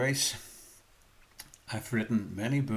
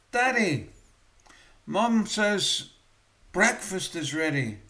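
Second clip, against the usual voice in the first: put-on little girl voice; 7 semitones higher, 7 dB louder than usual.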